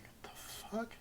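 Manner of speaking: breathless whispered disbelief, pitched high; stunned whisper. Retold or imitated. To stepdad on the phone, a shocked high whisper. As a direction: barely voice it, in shock. Hearing brother died on phone.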